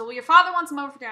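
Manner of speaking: loud, fast curt retort; sharp. Retold or imitated. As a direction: snap it back quickly. Mom's sharp reply.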